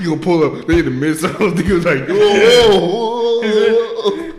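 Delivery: very loud, high, laughing retelling; hollering, voice climbing into mock alarm; excited uproar. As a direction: shout it over your own laughter. Excited retelling of knife scene.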